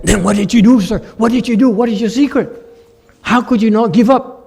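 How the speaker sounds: keen reporter pleading for the answer; eager interviewer. Retold or imitated. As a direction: press him for the secret. Eager interviewer pressing for secret.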